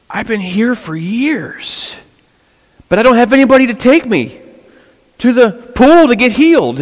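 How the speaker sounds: high, plaintive complaint rising in pitch; pleading paralyzed man. Retold or imitated. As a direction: whine it like a helpless man begging. Paralyzed man answers Jesus, voiced.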